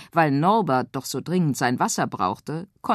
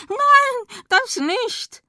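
very high, loud frog shriek; horrified scream; 6 dB louder, much slower than usual. Acted. Frog shrieks at the girl about the tap water, high and loud. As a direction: recoil from the water in horror. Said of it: "entsetzt aus und schrie".

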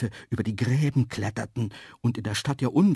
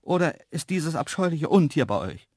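higher question leaning on Untier; wary disgust; 6 semitones higher, 4 dB louder than usual. Acted. Narrator asking antelopes about the beast, wary and disgusted. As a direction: ask with distaste through the door.

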